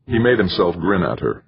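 ordinary delivery

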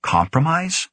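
puzzled echo, pitch rising high; wondering aloud. A narrator's reading. Young scientist echoing the director's word, wondering aloud. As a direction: query "Compromise?" with a high upward lift. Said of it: "Grant wondered aloud".

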